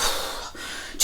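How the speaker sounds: impatient huffing sigh; acted huff, all breath; huff and puff. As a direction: let out a long puff of breath. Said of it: "So he huffs and puffs".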